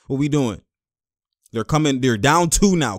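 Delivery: usual speaking voice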